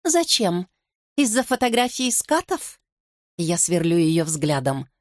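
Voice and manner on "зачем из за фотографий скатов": high-pitched, scornful questioning; caustic, raised-pitch comeback; snide retort. A narrator's reading. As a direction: throw it back with biting sarcasm. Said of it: "Она язвительно отвечает".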